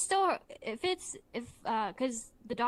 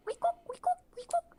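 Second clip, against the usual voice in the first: high squeaky week-oop; dentist tool noise; 3 dB louder than usual. Retold or imitated. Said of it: "He went".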